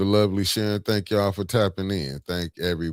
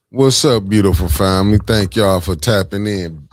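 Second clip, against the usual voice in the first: excited greeting; 3 semitones higher, 9 dB louder than usual. Real, not acted. Finally live, greeting the viewers.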